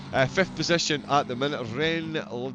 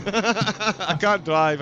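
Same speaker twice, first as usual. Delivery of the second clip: laughing; 6 semitones higher, 6 dB louder, much slower than usual. Real, not acted. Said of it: "I'm hanging up the microphone".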